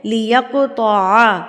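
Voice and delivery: لیقطع slow, ط rounded and heavy; slow emphatic لیقطع. Shown as a demonstration. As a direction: stretch لیقطع out with a thick ط. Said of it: "میں پڑھی ہوں لیقطع".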